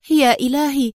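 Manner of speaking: sudden acted alarm, not hushed; alarmed murmur. A narrator's reading. Woman notices two men following them and exclaims in alarm. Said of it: "وهي تغمغم".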